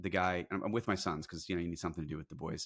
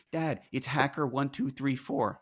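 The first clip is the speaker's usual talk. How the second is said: put-on higher, slow kid's voice; imitated kid; 7 semitones higher, slower than usual. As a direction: play a child informing Dad from the back. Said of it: "my kid in the back goes".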